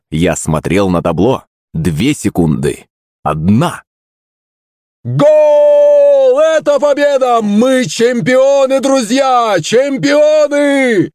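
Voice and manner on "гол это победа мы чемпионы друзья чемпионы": very high, drawn-out victory cry; triumphant announcer shout. A narrator's reading. Little monster winning at the buzzer, jubilant announcer scream. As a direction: scream it like a commentator at the winning goal. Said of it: "подытожил Кузя".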